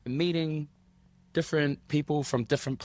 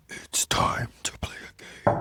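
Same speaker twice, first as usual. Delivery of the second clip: creepy whisper. Pretend. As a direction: whisper it like a menacing invitation to play. Announces game in creepy horror whisper.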